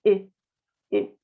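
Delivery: "E" said through lips set for O; short rounded French "E". Shown as a demonstration. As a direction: shape an O, say "E". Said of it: "en lugar de la O decimos una E".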